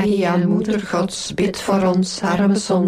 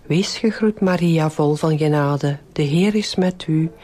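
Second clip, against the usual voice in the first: even, measured rosary lead; devout, rote Hail Mary cadence; prayer recitation. Real, not acted. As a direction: recite a well-known prayer in steady phrases.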